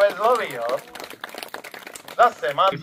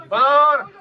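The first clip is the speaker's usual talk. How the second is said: sudden loud, high cry; warning shout; 8 semitones higher, 6 dB louder than usual. Real, not acted. Announcer warning the boy as the kite dives, loud. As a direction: shout the alarm. Bird swoops at the volunteer.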